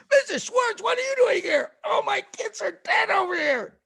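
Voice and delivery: high loud shout, leave me alone; a grieving Egyptian yelling through the door; angry grieving neighbor. Retold or imitated. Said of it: "and he goes".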